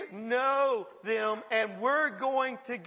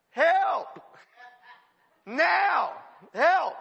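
higher, louder hammed-up plea for help; mock desperate cry; 5 semitones higher, 7 dB louder, much slower than usual. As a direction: shout it like a panicked emergency prayer. Mocking a desperate prayer life.

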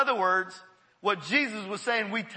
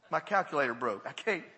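ordinary delivery

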